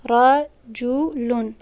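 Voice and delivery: رجلون stretched out slowly, syllable by syllable; teacher drags out رجلون so students hear it; slow careful model word; very slow demo. Shown as a demonstration.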